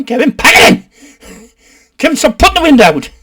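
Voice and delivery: high, loud mock yell; parent's telling-off. Parent scolding Kevin over the window, loud mock yell. Voicing a parent yelling at child.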